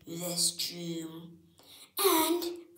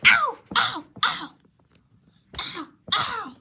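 yelps of pain; 12 semitones higher, 9 dB louder than usual. Acted. Toys cry out fighting.

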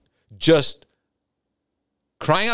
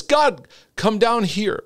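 drawn-out pleading call to God, pitched up; demanding prayer; 9 semitones higher, slower than usual. Retold or imitated. Preacher mimics an overwhelmed believer: slow, high pleading call. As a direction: stretch it slowly like a needy prayer.

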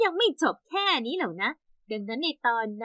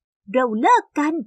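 louder, very slow mock yell; retold shout; 5 dB louder, much slower than usual. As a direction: spell out the breakup with a mild shout. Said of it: "ตะโกนใส่หน้าเขาว่า".

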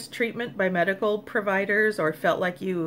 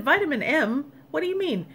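higher-pitched, puzzled question; baffled echo; 8 semitones higher than usual. Retold or imitated. Patient asks the doctor what he means, baffled and higher. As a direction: retell a disbelieving echo, pitched up. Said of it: "I said".

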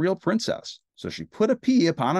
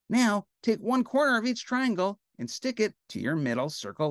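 put-on high, lively little-girl character; eager, high-pitched girl puppet; Betty Lou; 7 semitones higher than usual. A narrator's reading. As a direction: chirp the instructions in a high voice. Crafts told as Betty Lou.

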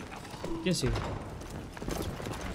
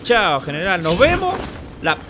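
mocking farewell; 3 semitones higher, 6 dB louder, slower than usual. Real, not acted. Taunting defeated general.

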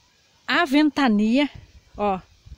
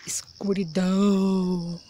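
whispering; 5 semitones lower, 4 dB quieter, much slower than usual. Pretend. Spooky word in the dark.

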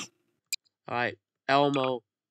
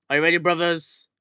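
loud, high war cry building to a yell; rally the troops, louder with each call; rallying battle cry; 6 semitones higher, 10 dB louder, slower than usual. Pretend. Player leading Elmo's brothers into battle, shouted rallying cry. Rallying his game team.

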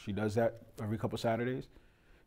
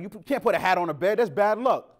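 loud, higher scolding grandmother; louder mock grandma scold; 5 semitones higher, 8 dB louder than usual. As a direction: warn the child off sharply. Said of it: "'cause she's like".

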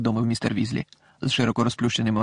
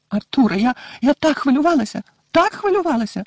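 high, worried motherly voice; a fretting mother, breathless with worry; anxious mother; 12 semitones higher, 4 dB louder than usual. A narrator's reading. Mrs Weasley runs to her husband, high and anxious.

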